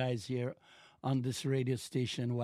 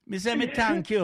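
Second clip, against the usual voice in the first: Jamaican patois thanks, "me" stressed, higher and louder; 9 semitones higher, 7 dB louder than usual. Shown as a demonstration. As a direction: say it the Jamaican way with a punch on "me". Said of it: "how do I say that in Jamaican?".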